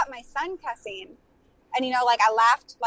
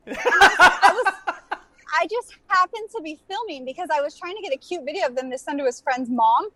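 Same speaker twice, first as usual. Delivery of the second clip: ordinary delivery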